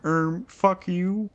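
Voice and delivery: high, slow, put-on puppet voice; high-pitched, slowed-down puppet impression; Kermit. Shown as a demonstration. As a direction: draw it out in a high frog-puppet voice.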